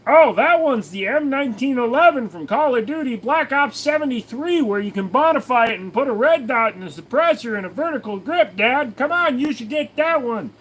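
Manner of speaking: put-on high nagging kid voice; high mocking child voice; whiny kid. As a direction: pester Dad like a know-it-all kid. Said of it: "And they go".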